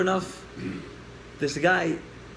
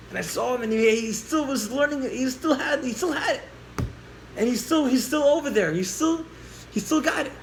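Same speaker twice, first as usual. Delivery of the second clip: high, tumbling, repeated restarts; excited report; 6 semitones higher than usual. Retold or imitated. Boy telling the mother what he saw, excited. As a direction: gush the good news, pitched up.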